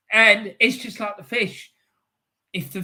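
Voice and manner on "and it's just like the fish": ordinary delivery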